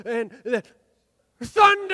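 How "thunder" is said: quick high shout of "Thunder!"; short shouted Thunder. Shown as a demonstration. Leading the crowd's practice yell, short high shout. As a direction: bark "Thunder!" out sharply. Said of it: "let's all practice yelling".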